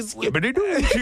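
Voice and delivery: ordinary talk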